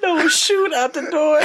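high, slow, laughing words; cracking up while telling it; laughing retelling. Retold or imitated. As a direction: squeeze it out through laughter. Retells child's act while laughing.

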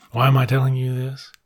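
ordinary talk

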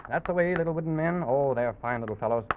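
ordinary delivery, nothing marked

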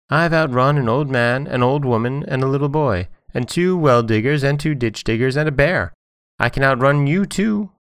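high, bragging little character voice; boastful Johnny Cake. A narrator's reading. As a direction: rattle off the list as a cheeky boast. Johnny Cake brags to the wolf.

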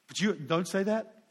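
normal talking voice

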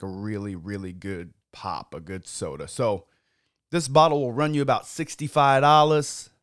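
ordinary talk

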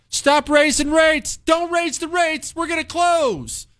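high, whiny mock scream of the banks; mock panicked banks. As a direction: squeal it like a panicked banker pleading. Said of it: "the banks are screaming at the Fed".

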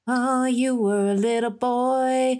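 mock sentimental montage. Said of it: "sweet music".

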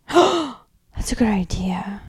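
excited conspiratorial whisper. Real, not acted. Cohost whispering back about the Barbie-chair diorama, hushed and thrilled. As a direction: breathe it like a secret plan.

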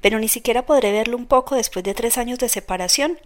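usual speaking voice

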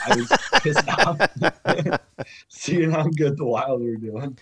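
laughing through the words. Real, not acted. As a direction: chuckle while admitting it. Laughing while admitting envy.

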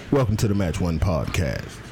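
ordinary talk, nothing marked

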